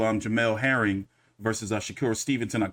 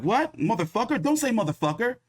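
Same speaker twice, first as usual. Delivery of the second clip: mock angry Canelo; 9 semitones higher than usual. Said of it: "he gonna be like".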